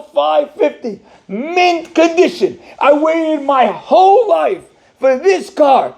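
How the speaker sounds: high, slow, drawn-out shouting rant; livid rant. Retold or imitated. Owner rants at the rabbi about his mint car, high and slow. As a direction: pour out outrage over the scratch.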